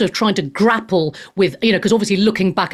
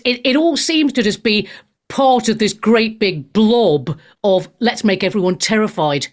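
ordinary talk, nothing marked

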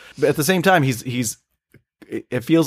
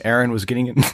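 usual speaking voice

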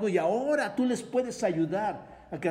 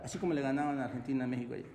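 normal talking voice

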